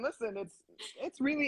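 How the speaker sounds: ordinary talk, nothing marked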